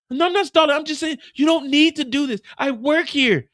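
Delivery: high loud panicked denial; high, loud mock panic; frantic protest. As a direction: protest desperately. Mireya protesting.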